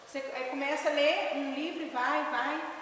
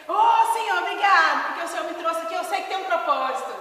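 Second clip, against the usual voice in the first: loud, high mock fervent prayer; crente orando alto; 4 semitones higher, 10 dB louder than usual. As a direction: imitate an over-zealous believer crying out thanks. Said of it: "chegou orando em línguas". (imitando oração fervorosa, aos gritos)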